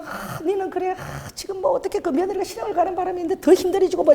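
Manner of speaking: high, hushed mock gossip; gossiping church ladies. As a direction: imitate church women commiserating softly. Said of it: "교회 권사님들이 모이면은".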